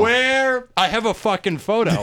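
high, emphatic protest; emphatic insistence. Retold or imitated. Insists his story is true.

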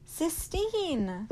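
high, loud scream of her name. Acted. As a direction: scream it across the distance. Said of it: "he screamed".

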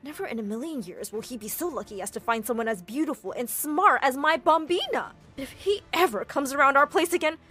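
high, loud outraged mother; furious mom. Acted. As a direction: rant in defense of your child, threatening. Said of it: "Mom was beyond furious".

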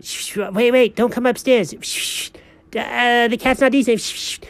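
high, hushed then urgent cat; the cat pleading in a high voice, shushing. Acted. Cat voice warning the dog.

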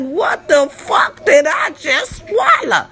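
high, drawn-out cry of disbelief; acted-out high, strained exclamation; retold choking shock. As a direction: wail it slowly, hammed-up outrage. Acts out her shock while choking.

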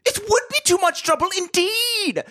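put-on very high, slow shrieking old woman; hammed-up falsetto old woman crying out; shrill old lady. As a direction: squawk it like a batty old lady. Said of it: "Missus Applegate cried".

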